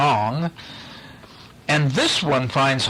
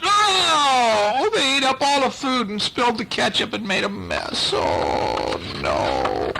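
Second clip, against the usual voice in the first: upset monster; 9 semitones higher, slower than usual. Pretend. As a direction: cry no like a monster who lost his food. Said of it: "and he's going".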